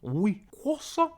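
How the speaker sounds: high, slow French questioning; slow, high-pitched French-accented voice; mock French accent. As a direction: play a puzzled French dog. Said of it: "because of the Frenchness".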